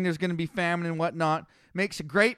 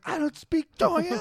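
squeaky protest; 11 semitones higher than usual. Retold or imitated. Other builder protests.

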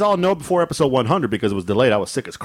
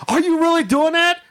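much higher, mock disbelief; incredulous wife. Wife finding husband in frozen vegetables, mock incredulous. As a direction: imitate an appalled wife. Wife's disbelief acted in story.

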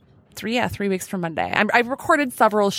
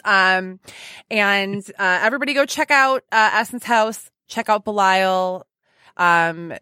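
ordinary talk

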